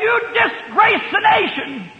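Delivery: high slow shouted rebuke; rebuking shout. Retold or imitated. Preacher rebuking the drunk women, shouting high and slow. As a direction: thunder the rebuke at the sinners.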